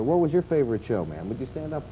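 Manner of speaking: ordinary talk, nothing marked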